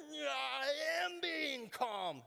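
slow, high, loud strained yell; angry person insisting they are calm; mock furious. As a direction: scream that you are calm, hammed-up. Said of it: "And then you're like".

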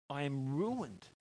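quiet, slow confession of ruin; hushed despair. Acted. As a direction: let the words sink in quietly. Isaiah's words repeated, hushed.

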